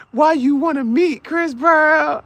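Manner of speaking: put-on high, slow whimper of a heartbroken boyfriend; mock crying. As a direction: whine it out like you're about to cry.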